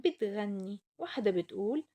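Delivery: usual speaking voice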